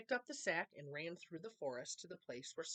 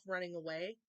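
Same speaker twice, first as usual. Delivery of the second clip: usual speaking voice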